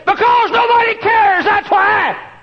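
very high, loud, slow scream; screamed reply. Retold or imitated. Teenage girl screaming at the preacher, loud and desperate. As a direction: scream it back in despair. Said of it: "she screamed at me".

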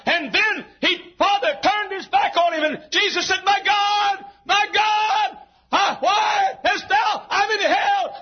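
very high, sustained pulpit shouting; full-force preaching. Real, not acted. Preacher acting out the taunts and cry from the cross, full-force preaching. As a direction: cry out the crucifixion at full pitch.

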